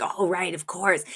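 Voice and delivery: understated sheepish agreement; imitated realization. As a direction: concede it quietly, as if just remembering. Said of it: "And Val kind of has a".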